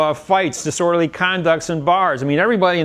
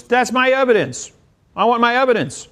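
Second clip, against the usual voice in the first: high, loud, indignant protest; indignant client; 10 semitones higher, 4 dB louder than usual. Retold or imitated. Client protesting to the judge, high and indignant. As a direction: squeal it like an outraged defendant.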